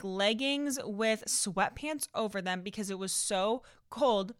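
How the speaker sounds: usual speaking voice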